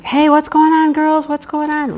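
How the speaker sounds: high, loud call to the hens. Retold or imitated. Backyard owner calling to her panicking chickens, calling out. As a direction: holler a question, pitched right up.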